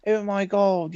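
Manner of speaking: high, hammed-up English accent in the style of Russell Brand. Mocking the old voice actor's high, hammed-up English accent. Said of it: "like, Russell Brand English accent".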